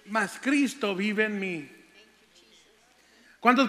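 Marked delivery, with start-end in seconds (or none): 0.1-1.7 very slow, deliberate verse ending; measured proclamation. Acted. Preacher completing his favorite verse, slowly and deliberately. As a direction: draw out each word for the congregation.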